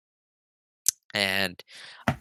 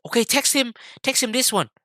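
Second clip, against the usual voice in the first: high-pitched, insistent parent giving orders; bipolar mom; 9 semitones higher than usual. Retold or imitated. Mom telling her son to text the driver, high and insistent. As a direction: imitate a mom issuing instructions, pitched well up. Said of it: "kept being bipolar and was like".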